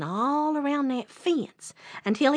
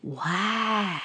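awed bear. Acted. Bear is taken in by the rabbit's job, drawn-out awe. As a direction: stretch it out in slow wonder. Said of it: "said the bear".

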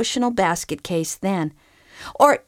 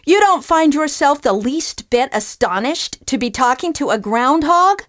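higher, louder tirade; ranting groundhog; 5 semitones higher, 6 dB louder than usual. Acted. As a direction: tear into the ungrateful bum. Groundhog rants at fisherman.

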